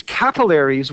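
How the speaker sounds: capillaries as "CAP-uh-lair-eez", stress up front; first-syllable stress and a "lair" sound in capillaries; rejected pronunciation. Shown as a demonstration. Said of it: "would have to be spelled like this".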